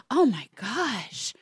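slow, hushed, drawn-out awe; lightly acted wonderstruck gasp; mock teenage awe. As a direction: act out a starstruck high schooler. High schooler awed by worldly girl.

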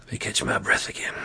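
quick breathless whisper under the breath; whispered aside. Real, not acted. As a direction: whisper it to yourself, rushed. Whispered aside mid ad read.

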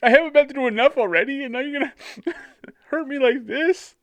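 high, fast hammed-up whine, breaking into laughter; mock wounded Joseph. Joseph weeping over brothers' message, mock whiny wail. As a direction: overdramatic hurt protest. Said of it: "The guy's like".

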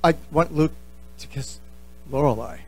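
the same confession, now shrinking and fading; sheepish repeat. Retold or imitated. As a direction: mumble it again, embarrassed. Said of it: "He said".

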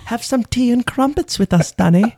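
put-on high, eerie British child voice; mock-creepy high British sing-song; creepy British twins. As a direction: parody a creepy English twin offering tea. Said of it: "the accent is creepier".

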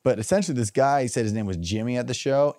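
usual speaking voice

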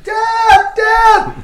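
very high, loud panicked screams for dad; desperate high yells through a locked door; retold screaming. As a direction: scream for help like a trapped child.

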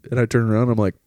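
usual speaking voice

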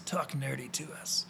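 slow, soft, sultry put-on voice; smoky pretend-sexy murmur; mock sensual. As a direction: draw it out softly like a jokey seduction. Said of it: "in a real central voice".

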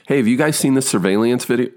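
high, bright line reading; acting an audition line. Actor reading his first audition line, upbeat. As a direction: deliver the scripted line with energy.

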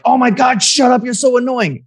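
mock-snap at the kid, fed up; irritated parent. Parent snapping at nagging kid.

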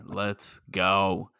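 normal talking voice

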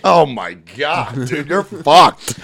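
slow, drawn-out mock dismay; imitating a friend's doomed verdict; retold dismayed reaction. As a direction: stretch it out like bad news sinking in. Retold dread at hidden tank.

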